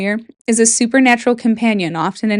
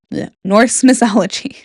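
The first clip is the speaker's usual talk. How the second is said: ordinary talk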